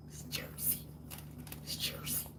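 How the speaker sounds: unvoiced whisper, barely audible; hushed, repeated punchline in a whisper; joking whisper. Real, not acted. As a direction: whisper it as a sly aside. Repeats the joke under breath.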